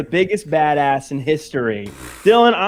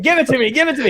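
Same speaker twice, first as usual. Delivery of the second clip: mock eager plea; 8 semitones higher, much faster than usual. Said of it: "You're like".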